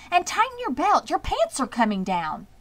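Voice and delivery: fussing aunt crying out; scolding cry. A narrator's reading. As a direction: call out a fussing reprimand. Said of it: "cried Miss Zuckerman".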